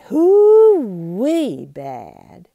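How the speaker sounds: loud, slow drawn-out hoo-wee; emphatic drawl. A narrator's reading. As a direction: stretch it out big and loud. Narrator emphasizing Bart's badness.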